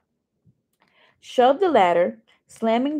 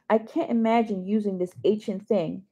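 ordinary delivery, nothing marked

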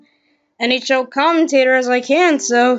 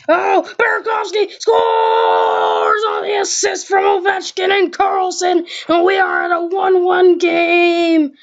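excited; 5 semitones higher than usual. Pretend. Goal called in commentary.

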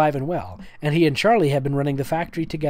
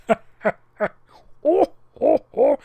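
laughing; 10 semitones higher, much slower than usual. Real, not acted. Laughing at the fan fiction.

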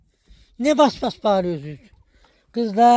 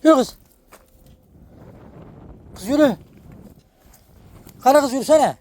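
shooing shout; 7 semitones higher than usual. Real, not acted. Driving hens on.

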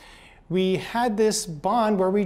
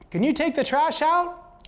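high, louder question called from another room; calling out a request; 10 semitones higher, 4 dB louder than usual. Retold or imitated. Wife calling to husband in the living room, raised voice. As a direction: shout the chore across the house.

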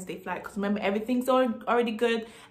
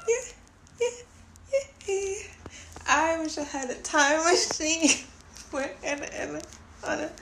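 tearful; 7 semitones higher than usual. Real, not acted. Tearful wish for time machine.

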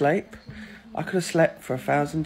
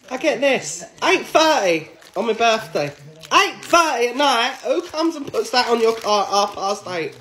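loud, raised-pitch indignation; indignant rant; 6 semitones higher, 6 dB louder than usual. Real, not acted. Driver complains about a ticket on their birthday, indignant. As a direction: protest it loudly and higher.

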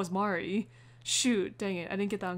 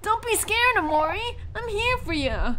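much higher, louder, pushing the throat hard; strained Kel voice; 12 semitones higher, 6 dB louder than usual. Acted. Reassuring a scared friend, strained loud Kel voice. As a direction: belt it out like a big brave friend. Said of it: "am I pushing my throat too hard".

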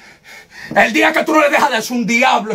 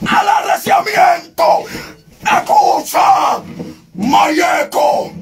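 ecstatic tongues cry; 3 semitones higher, much slower than usual. Real, not acted. Preacher calls for more fervor, crying out high in tongues. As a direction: cry out in the spirit, stretched and high.

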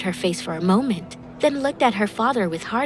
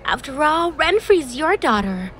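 put-on high, pleading girlish voice; raised, placating plea; 8 semitones higher, 3 dB louder than usual. A narrator's reading. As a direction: plead with an angry uncle in a much higher pitch. Girl pleads with angry father.

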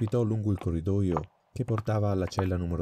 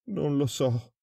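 frightened prisoner; 8 semitones higher, slower than usual. A narrator's reading.

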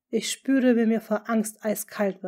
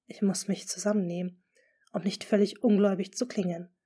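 usual speaking voice

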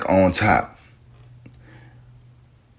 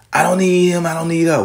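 quoting "I don't need him" in a higher, scoffing voice; mock dismissive voice; 7 semitones higher than usual. Mocking men and women who say they need no one, higher. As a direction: pitch up and toss off the quote. Mocking how people talk.

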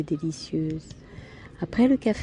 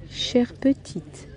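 put-on very slow, higher, halting old man; laboured articulation; 7 semitones higher, much slower than usual. A narrator's reading. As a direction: struggle to get the words out slowly. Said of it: "finit-il par articuler". (articulation lente et pénible)

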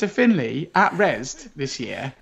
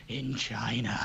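deadpan murmur; 6 semitones lower, 14 dB quieter than usual. Pretend. Trailer deadpan tag.

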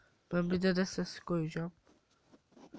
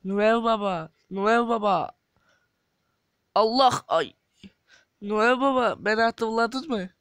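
Noel Baba'ya yüksek sesle seslenen; seslenerek; 6 dB louder than usual. Acted. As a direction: uzaktan çağırır gibi bağır. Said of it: "düşenin sesini yapacağız". (yüksek sesle seslenme)

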